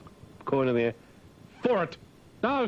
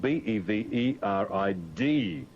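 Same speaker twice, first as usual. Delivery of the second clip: spelling it out; 5 semitones lower, slower than usual. Shown as a demonstration.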